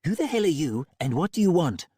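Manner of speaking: a hint of tough-girl Roxy. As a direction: bark it like a hard case. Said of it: "Molly put on her Roxy voice".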